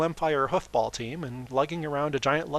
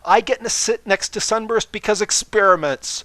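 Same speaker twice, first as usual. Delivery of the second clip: overeager; 8 semitones higher, 11 dB louder than usual. Acted. Twilight still overeager.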